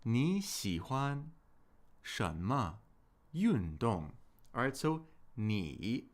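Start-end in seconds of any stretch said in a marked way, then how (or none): 0.0-4.1 word by word, 你喜欢, 什么, 运动; slow model reading. Shown as a demonstration. Teacher models the topic for learners, slow and segmented. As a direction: slowly separate 你喜欢, 什么 and 运动 with pauses.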